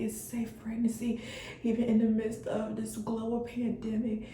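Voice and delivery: hushed emotional prayer to God; tearful whispered prayer. Real, not acted. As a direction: pray softly through tears. Tearful prayer after the result.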